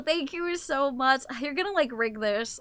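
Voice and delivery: usual speaking voice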